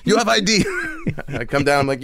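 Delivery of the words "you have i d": Jamaican guard insisting on ID; Jamaican accent. Retold or imitated. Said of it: "the Jamaican security guards".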